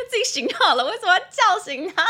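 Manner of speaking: high-pitched laughing mock complaint. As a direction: laugh through the grumble. Imagining partner's annoyed thoughts. (边笑边抱怨，声音拔高)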